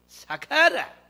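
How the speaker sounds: high, drawn-out Gyeongsang retort; Gyeongsang dialect. Shown as a demonstration. Preacher showing spouses how to stop a quarrel, Gyeongsang dialect. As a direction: hush them in Gyeongsang dialect, stretched out. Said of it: "경상도 말로 이거 멋진 말이".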